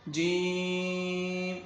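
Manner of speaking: جیم with a very long held "ee"; long madd. Shown as a demonstration. Teacher models five-count stretch, جیم held very long. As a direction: chant جیم slowly on one steady note. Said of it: "پانچ الف یہاں پر کھینچ کر پڑھیں گے".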